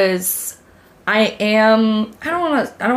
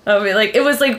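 ordinary talk, nothing marked

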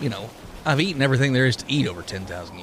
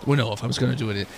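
usual speaking voice

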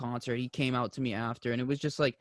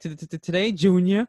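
hammed-up stammer, a bit higher and louder; Adam Sandler stutter; 7 semitones higher, 6 dB louder than usual. Fan joking about his own nerves, mock Adam Sandler stutter. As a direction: do the goofy movie stutter. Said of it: "stuttering like Adam Sandler".

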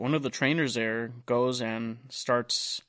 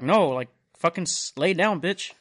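higher, louder mock yell; imitating paramedics shouting at a fighting patient; retold paramedic yell; 5 semitones higher, 6 dB louder than usual. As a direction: bark it like a fed-up paramedic pinning him down. Paramedics yelling at belligerent patient.

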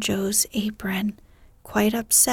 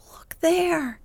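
second look there pitched higher, rising eagerness; excited urging; 7 semitones higher than usual. A narrator's reading. Sisters urging Beth to look, excited higher call. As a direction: lift the second look there up high, pointing. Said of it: "all pointing and all saying at once".

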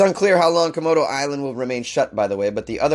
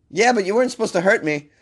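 higher-pitched whiny comeback, played for laughs; mock aggrieved protest; 7 semitones higher than usual. Podcaster playing the shot man arguing with his neighbor, mock aggrieved. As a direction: argue back like a wronged buddy.

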